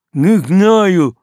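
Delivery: mumbled through a gag; gagged. A narrator's reading. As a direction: shout through a mouth gag. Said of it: "мычит боцман".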